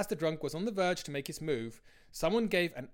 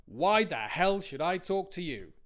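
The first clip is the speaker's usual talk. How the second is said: slow, louder belligerent challenge; 3 semitones higher, 6 dB louder, slower than usual. A narrator's reading. Drunk labourer snapping back at the old man, slow and belligerent. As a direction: square up to him with words. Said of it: "with a belligerent".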